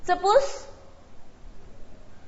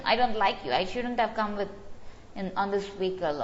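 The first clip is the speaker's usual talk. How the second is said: ordinary talk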